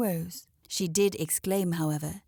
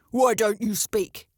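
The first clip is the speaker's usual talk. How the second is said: loud, slow demand; 6 dB louder than usual. A narrator's reading. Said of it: "he demanded".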